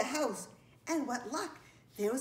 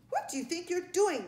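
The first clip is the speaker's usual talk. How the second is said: high, raised scolding voice; indignant challenge; 8 semitones higher, 4 dB louder than usual. A narrator's reading. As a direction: demand an answer as the cross ladybug. Ladybug repeats its yelled challenge.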